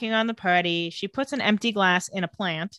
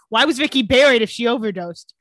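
pushy interrogator; 6 dB louder than usual. Acted. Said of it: "Jeremy really Michael Moores the sheriff".

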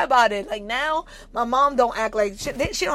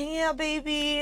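soft, higher, sugary prissy voice; mock-dainty and hushed; mock nice; 4 semitones higher, 9 dB quieter, slower than usual. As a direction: coo it with put-on sweetness. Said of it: "you gotta be all nice and".

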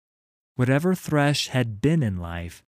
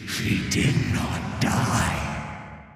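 slow rasping ghostly whisper; a sinister wraith savouring torment; cold hissing layered whisper; 4 semitones lower, much slower than usual. Acted. Thresh's voice.

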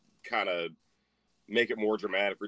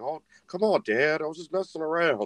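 protesting son; 5 semitones higher than usual. Retold or imitated. Son making excuses to his dad.

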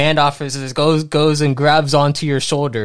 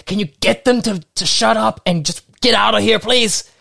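high, louder yell across the room; officer yelling; 11 semitones higher, 4 dB louder than usual. Acted. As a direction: call over the crowd to another officer. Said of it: "he yells over towards another officer".

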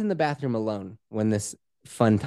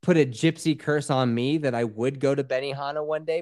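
normal talking voice

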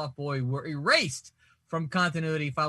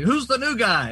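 mock Green Arrow; 6 semitones higher, 6 dB louder than usual. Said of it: "Green Arrow is like".

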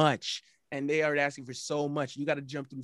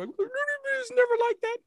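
high mocking falsetto; slower than usual. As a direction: mimic an offended woman. Mocking women's objection.

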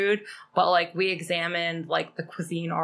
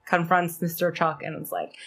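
usual speaking voice

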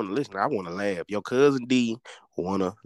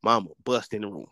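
normal talking voice

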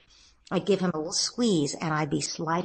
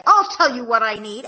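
a hint of a snippy kid, a bit louder; mock curt tone; 4 semitones higher, 7 dB louder than usual. Son answering his sister with a touch of attitude. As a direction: say it with a little edge. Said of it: "even if he has that tone of voice".

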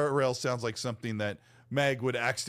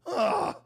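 disgusted ugh; 13 semitones higher than usual. Retold or imitated. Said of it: "I'm like".

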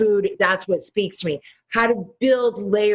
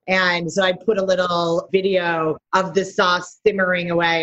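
usual speaking voice

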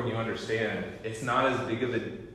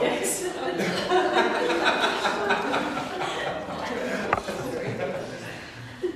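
high wheezing laugh; 4 dB louder than usual. Real, not acted. Long laugh continues.

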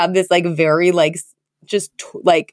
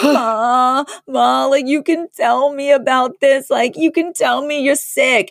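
higher, pleading Fran voice with a touch of mock tears; imitating Fran crying; 7 semitones higher, slower than usual. Fran begging her mother to admit she is sick, in a higher, mildly mock-teary voice. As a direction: beg like a lightly mimicked weepy Fran. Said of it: "literally instantly starts crying".

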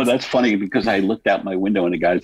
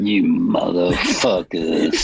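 slow, drawn-out country voice; Sling Blade; slower than usual. Retold or imitated. Co-host imitating a voicemail caller, slow country drawl. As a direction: mimic a Southern drawl, stretching the words. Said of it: "kinda like Sling Blade".

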